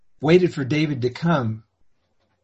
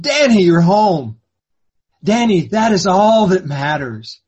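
joyful welcome; 4 semitones higher, 6 dB louder than usual. Acted. Father greets returning son.

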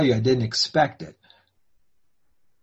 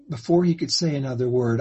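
usual speaking voice

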